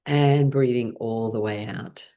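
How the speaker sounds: settled meditation-guide delivery; guided mindfulness. Shown as a demonstration. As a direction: ease them into stillness, leaving space between phrases. Leads mindfulness check-in.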